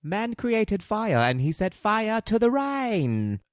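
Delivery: high, slow mock Cockney; grandly drawn-out bad British accent. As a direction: intone it as a hammed-up Cockney diva. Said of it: "terrible accents".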